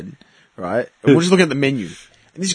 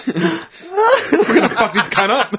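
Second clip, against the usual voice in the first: laughing; 9 semitones higher, much slower than usual. Real, not acted. Joking amid laughter.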